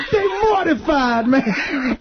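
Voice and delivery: high, gleeful put-on voice; happy Tracy Morgan. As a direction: crow it happily in a high comic impression. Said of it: "he was happy that they".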